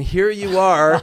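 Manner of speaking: slow, drawn-out reveal, a little excited; building amazement. Retold or imitated. Guest tells host of finding him on air, building amazement. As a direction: retell the surprise, stretching each word. Telling of a surprise reunion.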